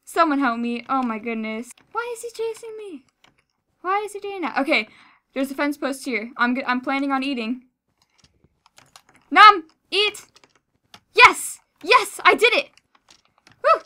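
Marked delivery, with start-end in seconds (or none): 1.9-4.6 high, soft whiny plea; high-pitched, quietly frightened; panicking gamer. Real, not acted. Being chased, confused fear. 9.3-13.8 high, loud victory shouts; triumphant cheer. Real, not acted. Eats, escapes, celebrates.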